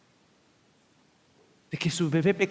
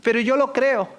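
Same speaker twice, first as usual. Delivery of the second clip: put-on higher, bold child's protest; brave child; 8 semitones higher, 4 dB louder than usual. A boy answering his mocking teacher. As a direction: stand up for your faith politely. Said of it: "el niñito se levantó y le dijo".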